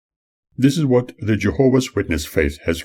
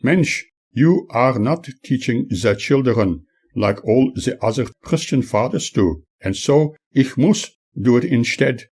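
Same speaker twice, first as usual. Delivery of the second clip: put-on broad German accent; 6 semitones higher than usual. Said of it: "telling me in her broad German accent".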